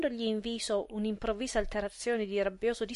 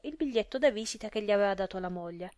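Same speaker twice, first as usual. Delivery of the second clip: ordinary delivery, nothing marked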